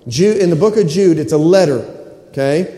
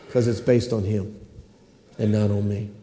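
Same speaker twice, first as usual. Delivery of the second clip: much softer and lower; quiet, intimate preaching; hushed aside; 9 semitones lower, 10 dB quieter than usual. Real, not acted. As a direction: drop it to a near hush. Softened conclusion of the point.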